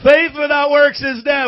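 high, loud corrected line; corrected shout. Shown as a demonstration. Pastor shouting the verse right after a slip. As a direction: nail it this time, full voice. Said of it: "I messed it up".

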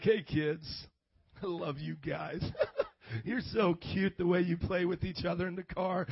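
low, syrupy put-on tenderness; mock sweet parent. Preacher acts a zapped parent greeting kids, low mock tenderness. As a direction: play a blissed-out parent, pitched well down.

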